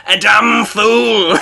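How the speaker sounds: slow, loud gloat. Acted. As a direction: drag it out with glee. Shark gloating.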